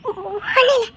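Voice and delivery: tearful, choked denial; crying. Acted. Wife hiding her tears from her husband, tearful. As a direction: hold back tears and deny it. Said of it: "ஏன் அழுற?".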